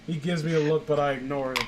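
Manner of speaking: usual speaking voice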